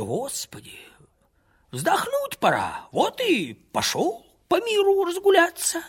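put-on high, slow voice of a weary old pilgrim; old wanderer. A narrator's reading. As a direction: content old man at the end of his road. Old pilgrim tells his story.

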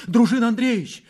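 high, loud, alarmed appeal; startled high-pitched plea; frightened cry. A narrator's reading. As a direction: call out his name in fright. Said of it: "сказала она в испуге".

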